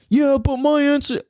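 high-pitched mocking objector; put-on high voice of a complainer. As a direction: mimic someone protesting, voice lifted way up. Mimics an imagined objector complaining.

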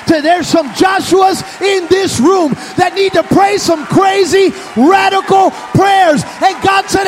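high, shouted sermon climax; full-force preaching. Acted. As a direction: preach at the top of your voice.

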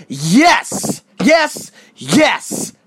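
loud, high bursts of yes; pumped-up shouting; exultant affirmation. Real, not acted. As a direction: punch each word like a motivational coach. Hyping the goal-setting method.

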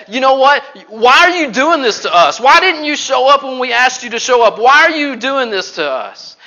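loud, high, pleading demand; hurt, accusing outcry; upset protest. Acted. Martha's imagined protest to Jesus.

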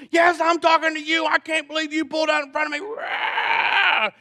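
very high, loud imitation of a ranting lady; mock irate screaming. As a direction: shriek it in outrage, ending in a roar. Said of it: "Karen screaming at me".

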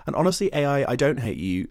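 usual speaking voice

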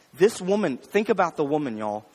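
usual speaking voice

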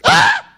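sudden very high, loud shriek; high-pitched scream. Retold or imitated. Lifter hears a snap in his body, piercing scream. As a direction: shriek as if hurt under the bar.

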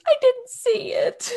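high, loud, slow mock wail; Rachel. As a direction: imitate a distraught mom-to-be at the ultrasound. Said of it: "Ross and Rachel are looking at their ultrasound".

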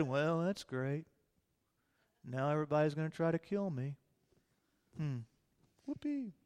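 low, soft deadpan sarcasm; a dry, tired whoopee; sarcastic weary shrug in the voice. Pretend. Sarcastic weary emperor reply.

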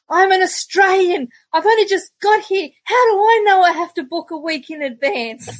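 high, exasperated plea for sympathy; pleading. Retold or imitated. Pleading with the pub host.

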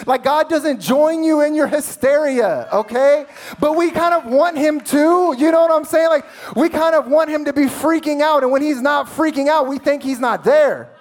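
loud, high, driving sermon delivery; preaching at full force. Real, not acted. Preacher exhorting his congregation, full-force preaching. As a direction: build to a fervent climax.

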